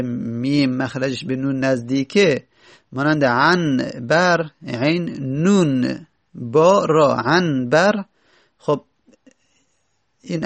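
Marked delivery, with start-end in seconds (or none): 3.2-8.0 عن بر spelled slowly, letter by letter; slow spelling out. Shown as a demonstration. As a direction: spell عنبر out slowly for students. (هجی کردن آهسته)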